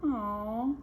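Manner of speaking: usual speaking voice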